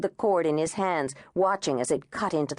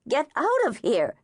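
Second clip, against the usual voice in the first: shrieking; 7 semitones higher than usual. A narrator's reading. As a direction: scream it at the intruder. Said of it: "she shrieked".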